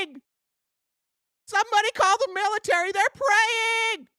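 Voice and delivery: very high mock hysteria; MAGA voice, yelling. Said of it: "Why am I yelling with this voice?".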